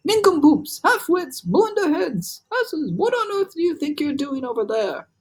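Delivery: high, loud jeering yell; yelling insults. Acted. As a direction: hurl the insults across the sky. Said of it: "yelling as loud as he could".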